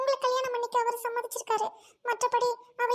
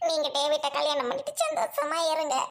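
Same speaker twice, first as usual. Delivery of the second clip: lower, crying with a broken laugh; laughing through tears; 7 semitones lower than usual. Acted. Bride gives up her groom to the doctor, crying with a broken laugh. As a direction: give him up while holding back tears.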